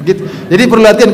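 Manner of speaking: ordinary talk, nothing marked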